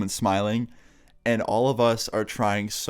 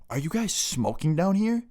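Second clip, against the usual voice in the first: high-pitched accusing mom voice; suspicious mom; 7 semitones higher than usual. Retold or imitated. Mom catching boys in the basement, suspicious mom. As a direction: question them like a suspicious mom.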